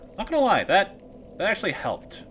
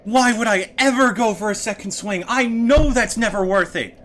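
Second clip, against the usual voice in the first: frustrated; 8 semitones higher, 6 dB louder than usual. Real, not acted. Berating himself after the loss.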